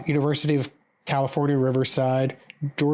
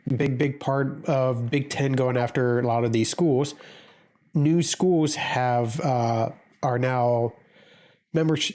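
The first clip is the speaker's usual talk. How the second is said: ordinary delivery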